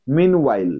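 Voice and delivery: meanwhile said as MEEN-while, both parts clear; meanwhile, clean two-part English pronunciation; correct meanwhile. Shown as a demonstration. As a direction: say meanwhile with a full long mean. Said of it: "উচ্চারণটা এরকম হবে".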